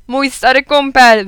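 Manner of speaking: loud, high, slow hearty cry; exclaimed greeting. A narrator's reading. Guest greeting his old school pal, hearty loud exclamation. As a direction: boom it out like a jovial fat man. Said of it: "wykrzyknął pan".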